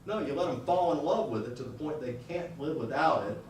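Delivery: ordinary talk, nothing marked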